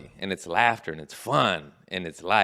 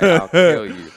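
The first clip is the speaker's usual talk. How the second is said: loud, high reenacted threat; hammed-up fighting yell; mock threat; 5 semitones higher, 10 dB louder, slower than usual. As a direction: throw out a heated threat for laughs. Acts out threat, ready to fight.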